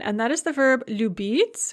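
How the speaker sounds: usual speaking voice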